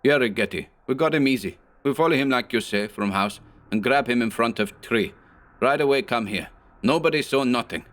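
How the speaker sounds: a hint of a big thug's broken accent; lightly voiced hog-necked henchman reporting; lightly done broken-English henchman; broken immigrant English. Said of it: "a big red-faced man with the neck of a hog".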